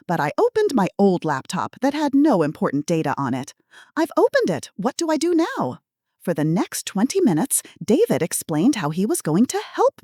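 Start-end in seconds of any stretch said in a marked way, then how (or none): 4.0-5.8 higher, fretful granny voice; confused old lady. Acted. Fake granny obeying the scammer, high and fretful. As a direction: fuss along like a flustered grandma. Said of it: "I maintained my confused elderly woman role".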